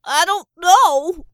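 high, very loud drawn-out scream; full-voiced pained shriek. A narrator's reading. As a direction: scream it in agony. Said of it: "Saint screamed".

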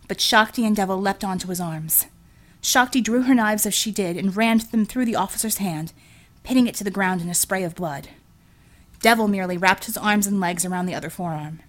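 ordinary delivery, nothing marked

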